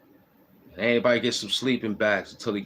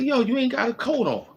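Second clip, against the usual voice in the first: pitched way up, disbelieving Yo; like spotting someone with no coat in winter; astonished retold yell; 3 dB louder than usual. Retold shock at coatless man.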